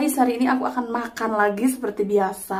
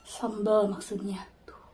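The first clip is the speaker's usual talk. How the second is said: quick whispered aside; whispering; 5 dB quieter, slower than usual. Real, not acted. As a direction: whisper the correction to yourself. Whispered self-correction aside.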